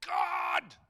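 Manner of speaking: rough mad prophet's yell at God; one loud outburst of prophetic anger; angry shout. Retold or imitated. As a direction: shout it angrily to the heavens. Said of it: "gets really just mad and he shouts".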